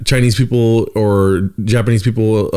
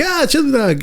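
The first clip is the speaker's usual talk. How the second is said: high excited cartoon hedgehog; much higher, cheery cartoon voice; Sonic; 14 semitones higher than usual. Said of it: "Sonic would be like".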